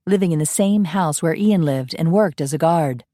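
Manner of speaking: usual speaking voice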